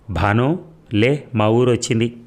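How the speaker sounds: plain reading voice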